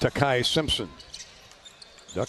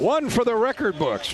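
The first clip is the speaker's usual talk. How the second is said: excited milestone call; 9 semitones higher than usual. Real, not acted. Milestone call, 2000 career points.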